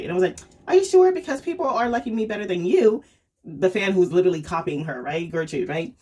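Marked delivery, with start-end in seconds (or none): 0.7-3.0 high, loud opening, easing off; mock challenge. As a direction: needle a jealous rival with a high-pitched question. Acted comeback in a rivalry quarrel.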